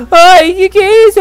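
high, slow startled cry. Real, not acted. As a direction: caught off guard by the screen. Sudden surprise in game. (susto agudo e lento)

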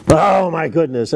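ordinary talk, nothing marked

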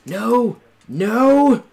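high screaming no; anguished scream. Real, not acted. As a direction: scream it as you die. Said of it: "I like your character voice there".

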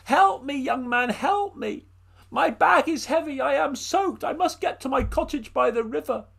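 put-on high, pleading old woman's voice; an old woman begging for help in the rain; high, pleading old woman calling out; old woman crying out.

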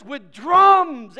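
booming exclamation; exultant shout. Acted. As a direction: belt it out to the congregation. Builds to exclaimed verse.

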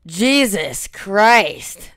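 slow, loud, high disbelief; stunned drawn-out exclaim. Real, not acted. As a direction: stretch it out in shock. Gamer reacts to a big attack.

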